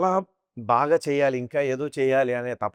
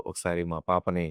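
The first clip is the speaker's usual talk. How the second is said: a hint of the grandfather's soft blessing voice; softly ask, as the old father would; lightly voiced late grandfather; 6 semitones lower, 5 dB quieter than usual. The late grandfather's voice blessing his granddaughter at her wedding, lightly done. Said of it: "మా నాన్నగారి గొంతుతో".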